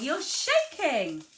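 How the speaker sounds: high, loud, slow "shaking"; "shaking" drawn out high and loud; emphasis on shaking. Shown as a demonstration. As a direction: punch "shaking" and stretch it out for a child. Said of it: "You could emphasize the word shaking".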